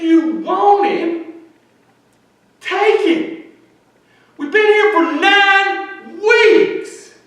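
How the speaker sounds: high, drawn-out full-force shouting; desperate outcry to God. Retold or imitated. Praying woman demands God heal him or take him, desperate shout. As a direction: plead with God at the top of your lungs.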